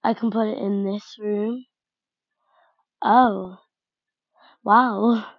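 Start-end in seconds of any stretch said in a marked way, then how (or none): none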